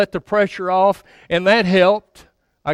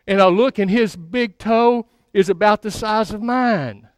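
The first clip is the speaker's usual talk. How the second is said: usual speaking voice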